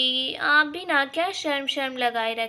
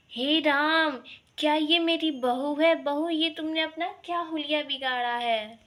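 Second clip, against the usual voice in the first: ordinary talk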